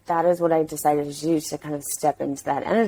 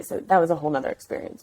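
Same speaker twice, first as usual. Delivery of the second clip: ordinary talk, nothing marked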